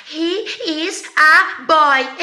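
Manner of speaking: put-on "He is a boy" dragged out word by word, high; mock over-articulation. Shown as a demonstration. Teacher mocking what students expect Americans to say, exaggeratedly slow. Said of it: "Você acha que o americano vai falar".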